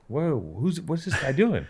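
high, soft, baffled; excited kid rambling. Retold or imitated. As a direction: gape at a stranger, hushed. Meeting his first emo.

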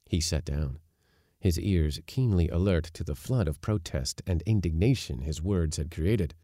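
usual speaking voice

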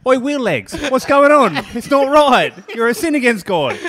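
high, loud jeering yell at a wheelchair user; mock street taunt. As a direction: bark it out like a schoolyard bully, half laughing. Mocks his old taunting self.

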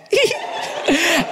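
high bright giggle. Real, not acted. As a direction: giggle at the word. Laughs at rock-hard cock.

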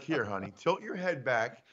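put-on soft, hushed, like giving medicine to a child; coaxing nurse. Wife feeding husband a Bloody Mary, soft and soothing. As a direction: talk him down gently as he drinks. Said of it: "be like".

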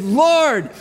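high, pleading cry for rescue; panicked shouts, pitched well up; acted yelling. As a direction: yell over a storm to wake him. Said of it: "Everyone is yelling. You can't be heard".

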